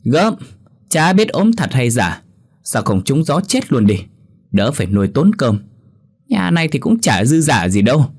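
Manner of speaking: high, pouting contempt; sneering scorn. Acted. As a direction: scoff at the sick mother-in-law. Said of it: "nghe vậy thì bĩu môi".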